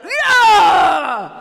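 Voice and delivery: long, loud hysterical yell; hysterical scream. Acted. Host showing the crowd public hysteria, hysterical scream. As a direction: let out a drawn-out full-volume wail. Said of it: "and all went".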